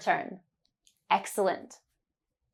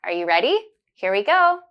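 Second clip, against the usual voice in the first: normal talking voice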